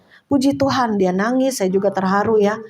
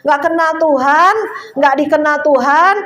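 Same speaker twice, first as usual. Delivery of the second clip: raised, higher preaching voice; preaching warning; 5 semitones higher than usual. Real, not acted. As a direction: press the warning on the congregation. (khotbah peringatan dengan nada tinggi)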